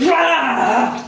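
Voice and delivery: sudden cold scream; shocked scream from the cold. Real, not acted. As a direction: yelp at the icy splash. Ice water hits her.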